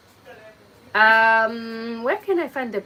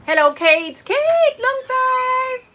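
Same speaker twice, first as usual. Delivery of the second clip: sing-song greeting; 4 dB louder, much slower than usual. Real, not acted. Greeting a viewer joining live.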